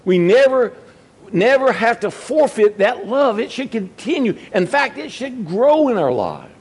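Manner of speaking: high, rising preacher's appeal; preacher pleading with the congregation to keep their first love, fervent; fervent preaching. Acted. Preacher urges love for Jesus.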